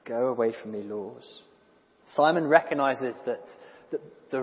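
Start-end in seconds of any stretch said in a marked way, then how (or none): 0.0-1.2 soft, hushed go away from me; hushed plea. Shown as a demonstration. Preacher hushes Simon's plea to Jesus, quiet.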